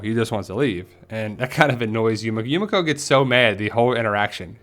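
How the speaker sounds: ordinary talk, nothing marked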